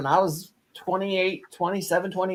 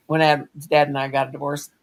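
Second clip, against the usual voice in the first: ordinary talk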